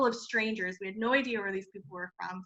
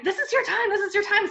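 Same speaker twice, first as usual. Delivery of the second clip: much higher, laughing push of encouragement; excited urging; 11 semitones higher, 5 dB louder than usual. Retold or imitated. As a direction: cheer her on, giggling and eager. Freundin drängt sie, sich zu melden.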